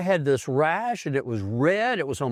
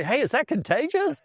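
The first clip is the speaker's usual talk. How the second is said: worried patient; 12 semitones higher than usual. Retold or imitated. Patients asking about the smell.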